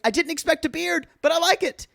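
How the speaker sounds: high, quick delighted imitation; retold freak-out. Retelling her reaction to his beard, high mock freak-out. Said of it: "she's freaking out".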